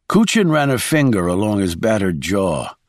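ordinary delivery

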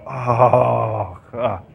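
put-on fed-up exhale; fake exasperation. Shown as a demonstration. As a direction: sigh it out as if worn down. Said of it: "Fake exasperation".

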